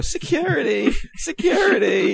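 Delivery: high-pitched, drawn-out cry for help; panicked call for security. Retold or imitated. Friend imagines Drac fleeing the fight, high and panicked. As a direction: squeal it like you're running away scared. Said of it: "Drac would go running and go".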